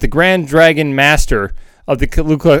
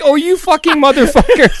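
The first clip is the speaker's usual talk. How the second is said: laughing outrage; 10 semitones higher than usual. Retold or imitated. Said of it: "It's just like".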